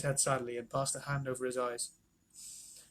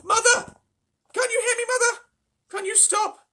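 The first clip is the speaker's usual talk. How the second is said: desperate pleading; 10 dB louder than usual. A narrator's reading. Mrs Bucket pleading with mother.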